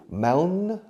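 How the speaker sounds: "Maun" pitched high and rising into the N; added final N. Shown as a demonstration. Teacher building mountain from Mao for learners, added final N. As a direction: say "Mao" and close it on an N. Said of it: "añades un N".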